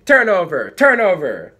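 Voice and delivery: loud high chant across the line; shouting. A narrator's reading. Opposing players taunting the ballcarrier, shouting. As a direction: yell it at the offense. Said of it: "the Knights were shouting".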